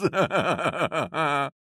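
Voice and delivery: high, rolling hearty laugh; elephant laughter. A narrator's reading. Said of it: "lachten die Elefanten".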